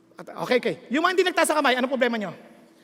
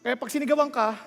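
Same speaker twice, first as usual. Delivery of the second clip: normal talking voice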